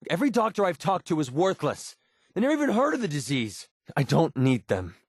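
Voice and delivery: high, exasperated outburst; bitter frustration. Acted. Dismissing useless doctors to a companion, frustrated and high. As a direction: vent about them with pitch climbing high.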